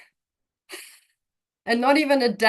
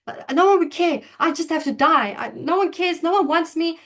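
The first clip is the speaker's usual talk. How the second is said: frantic crying; 7 semitones higher than usual. Retold or imitated. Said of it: "as I'm in frantic, crying, crying".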